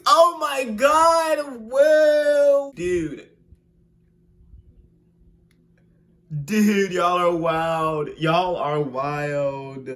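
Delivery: shocked. Real, not acted. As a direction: react in disbelief. Shocked slow exclamation.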